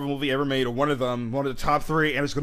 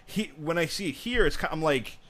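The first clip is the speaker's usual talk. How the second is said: ordinary delivery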